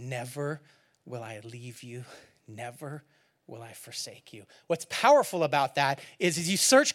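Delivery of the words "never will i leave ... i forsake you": soft promise. Acted. Reading God's promise softly.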